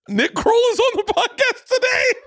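high squeaky giggling through words; can't get it out for laughing. Real, not acted. Restarting the intro, cracking up.

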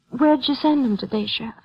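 ordinary talk

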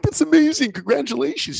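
hammed-up delight for a friend; excited congratulations. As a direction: leap up in pitch for the good news. Reacting to friend's news.

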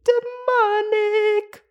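slow, drawn-out high put-on voice; theatrical spooky pronouncement; mock demonic. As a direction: ham up a creepy declaration. Dramatic quoted exclamation.

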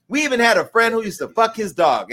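ordinary talk, nothing marked

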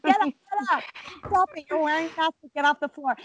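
high urgent hushed scolding; telling kids off in a store; scolding the boys. Retold or imitated. Mom hissing at her laughing son to get up.

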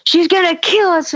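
high-pitched child's alarm, acted out; urgent, high mock panic; frantic kid. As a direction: imitate a panicked kid warning mom. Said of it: "I'm saying all these things".

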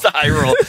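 "Tyrell" said high as TIE-rul; mocked mispronunciation. Mocking co-host's name slip, high "Tyrell". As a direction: imitate the slip, "Tyrell" with stressed first syllable. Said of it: "He goes".

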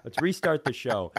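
normal talking voice

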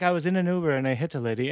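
barely tint it with an Irish accent; understated Irish accent, held back; subtle Irish accent. Shown as a demonstration. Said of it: "but, like, a tenth of that".

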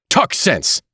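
high, sharp rebuke; sharply. A narrator's reading. Family physician to a frantic Mister Button, sharply. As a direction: cut him off with irritation. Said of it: "said Doctor Keene sharply".

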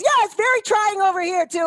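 director's dry jab, pitched high and pushed loud. Retold or imitated. Director teasing the actress mid-take, high, loud mock voice. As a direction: toss the sarcasm back up high and loud. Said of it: "He goes".

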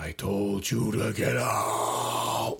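slow, menacing ghost warning. Retold or imitated. Ghost voice warning the wagon again, slow and menacing. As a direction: drag out the warning like a haunted voice.

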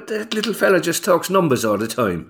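a playful hint of a rural Irish voice; Irish accent. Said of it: "I can do that accent".

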